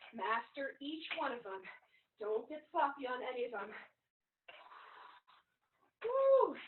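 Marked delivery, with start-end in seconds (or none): 6.0-6.6 cheer. Real, not acted. Trainer cheering mid-workout.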